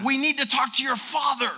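urgent confronting call; retold confrontation. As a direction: press the son firmly. Confronting the owner's son.

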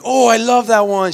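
retold burst of delight; enthusiastic dad. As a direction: gush loudly over your daughter's choice. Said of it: "She's all excited".

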